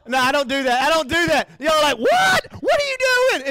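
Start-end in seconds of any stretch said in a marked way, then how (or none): 2.0-3.5 high, loud startled "what are you doing"; shocked outcry. Retold or imitated. Congregation reacting to the pastor's lullaby, loud shocked shout. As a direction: yell it in disbelief.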